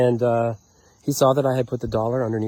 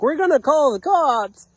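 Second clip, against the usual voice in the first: high, slow, loud mimicry of a threat; mock the neighbors' threat, pitched up and drawn out; 12 semitones higher, 6 dB louder than usual. Offended neighbors threatening to call police, mocking imitation.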